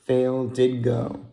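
plain reading voice

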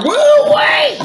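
high shouted woo; high whoop. Real, not acted. Reacting to strong drink.

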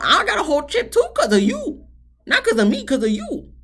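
high, loud mock outrage at a friend; indignant high-pitched accusation, acted out; mock indignation. Imagined confronting friend over chipped tooth.

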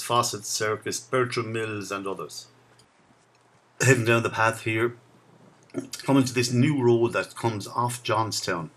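ordinary talk, nothing marked

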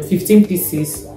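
usual speaking voice

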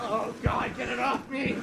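high, loud cry for help; panicked shout. Real, not acted. Player attacked in the game, panicked shout. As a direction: yell as if being grabbed.

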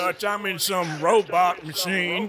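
Arthur Morgan. Acted. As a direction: talk like an outlaw cowboy. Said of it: "Arthur Morgan sounds more like this".

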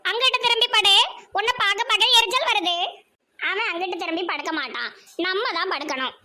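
ordinary delivery

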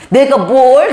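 mock indignant comeback; defensive retort. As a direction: snap back, caught off guard. Wife's retort voiced.

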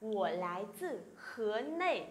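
slow, careful Mandarin question; slow, syllable by syllable Chinese demo; slow model reading. Shown as a demonstration. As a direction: read it out slowly for students to copy. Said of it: "Lại nào".

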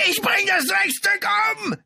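very high, loud screeched threat; furious screech. A narrator's reading. Brother storms past the lieutenant, screeching. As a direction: screech it in murderous rage. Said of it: "kreischte er".

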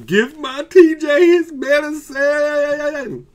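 high, loud, drawn-out yell at the desk; frantic demand. Pretend. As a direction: scream it slowly like you've lost all patience. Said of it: "start pounding and saying".

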